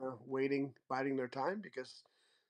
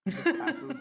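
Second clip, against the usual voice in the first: mock dismissive; 12 semitones higher, 7 dB louder than usual. Playful mock refusal.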